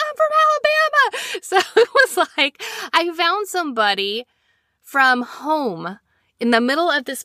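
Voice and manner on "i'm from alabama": very high thrilled squeal; excited. Retold or imitated. Traveller telling a fellow Southerner where home is, excited squeal. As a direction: squeal it out, overjoyed.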